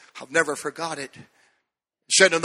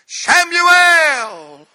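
loud, high call of the name; 11 semitones higher, 8 dB louder than usual. Retold or imitated. God calling the boy in the night, loud high call. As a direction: call out across the dark.

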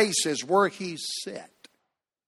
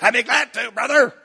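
imitation of a church member, pitched up; Brother Pate; 6 semitones higher than usual. Church member agreeing to teach, imitated voice. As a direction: do his eager reply in a put-on voice. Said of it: "That's how he talked".

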